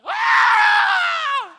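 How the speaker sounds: ear-splitting high scream; shriek of delight. Retold or imitated. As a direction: shriek like a fan losing it. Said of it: "I was just like".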